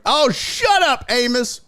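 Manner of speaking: high-pitched mock outrage; mock exasperated. Streamer telling off a chatter, mock exasperated.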